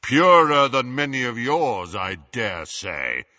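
slow, imposing put-on voice; deep, impressive voice. A narrator's reading. Harry as Runcorn silences the wizards, imposing. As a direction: start loud, sink slowly and haughtily. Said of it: "his deep voice echoed impressively".

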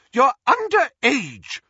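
high, loud and slow scolding; outraged, high-pitched motherly yell; mother's shout. A narrator's reading. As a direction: shout it high at a disobedient daughter. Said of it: "Missus Weasley shouted at her daughter".

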